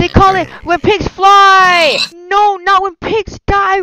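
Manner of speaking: loud, slow, high mock-tragic yell; dramatic shout. Real, not acted. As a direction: bellow it as the pig plummets. Pig falls to its death.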